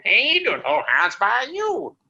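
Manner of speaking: high, slow hillbilly greeting; goofy hillbilly phone greeting. Retold or imitated. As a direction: answer the phone in a hammed-up hillbilly voice. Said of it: "Every time the phone rang".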